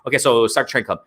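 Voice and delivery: normal talking voice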